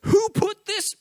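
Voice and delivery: stubbing a toe in the forest, outraged yelp; mock outraged yelp. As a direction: yelp it like you just stubbed your toe. I'd say all kinds of profanity.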